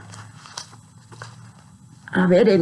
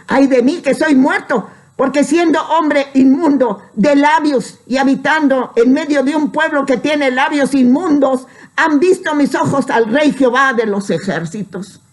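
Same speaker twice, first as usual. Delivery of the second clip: higher, sustained proclamation of a prophet's lament; fervent scripture recitation; 5 semitones higher than usual. Acted. Preacher quoting Isaiah on seeing God, fervent recitation. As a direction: declaim it as Bible verse.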